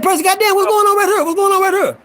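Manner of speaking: high-pitched, excited comic voice; Showtime impression. Storyteller mimicking Showtime arriving in the day room, high excited voice. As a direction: pitch it way up, imitating a motormouth jokester. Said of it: "He talk like this".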